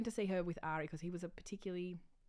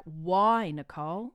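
mock indignant; 10 dB louder, slower than usual. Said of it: "I would have been like".

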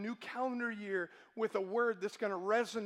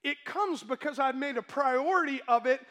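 louder, driving preacher cadence; preaching; 3 semitones higher, 4 dB louder than usual. Acted. Pastor driving home priorities to the congregation, preaching. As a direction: preach it hard to the room. Preaching builds to full force.

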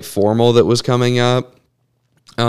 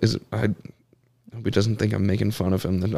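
ordinary talk

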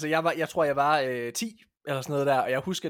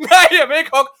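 high, loud mock whine of a scared kid; boy whining no at his father; whiny refusing boy; 11 semitones higher, 15 dB louder than usual. Boy refusing to chop hen.

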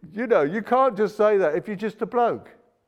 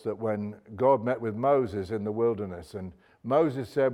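usual speaking voice

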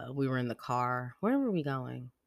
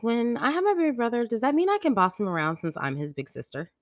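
higher, louder child imitation; bossy little kid; 10 semitones higher, 5 dB louder than usual. Bossy daughter asking about future brother, eager. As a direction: push an eager question in a kid voice.

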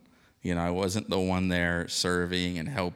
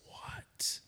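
whispered, much softer; stunned whisper; 10 dB quieter than usual. Retold or imitated. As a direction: breathe it in disbelief. Stunned at memorial service.

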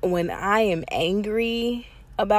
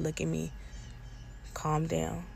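soft, steady retold calm-down; quiet calming; 10 dB quieter than usual. Partner telling her to calm down, soft and steady.

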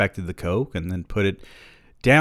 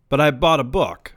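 flippant; 7 semitones higher, 6 dB louder than usual. A narrator's reading. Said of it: "I said flippantly".

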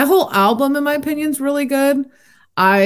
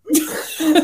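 giggling; 9 semitones higher than usual. Real, not acted. Laughing at derailed episode.

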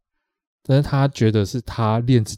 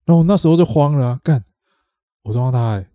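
慌张; 3 semitones higher, 5 dB louder than usual. Retold or imitated.

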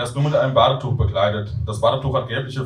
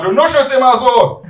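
high, loud demand; shouting granny; 13 semitones higher, 9 dB louder than usual. Acted. Grandmother who cannot hear her grandson, high shout. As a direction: holler the question down the line. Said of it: "schreit Oma".

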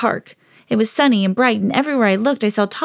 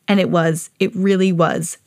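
usual speaking voice

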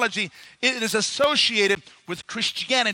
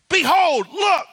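high, louder ringing call; proclaiming; 5 semitones higher, 4 dB louder than usual. Acted. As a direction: cry out behold to the crowd. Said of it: "He goes".

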